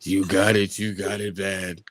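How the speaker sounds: low, slow zombie growl. Pretend. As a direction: drag it out like the undead. Zombie voice after request.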